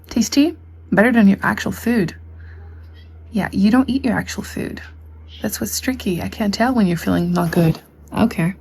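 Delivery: ordinary talk, nothing marked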